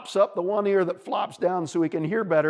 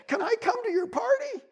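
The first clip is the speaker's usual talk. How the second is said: very high little voice; hopeful bunny. A narrator's reading. Bunny asks beaver for an invitation, hopefully. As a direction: ask in a small piping plea.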